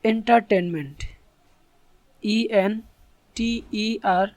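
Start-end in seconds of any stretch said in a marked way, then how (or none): none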